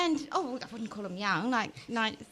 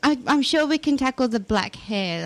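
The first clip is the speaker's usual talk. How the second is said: ordinary talk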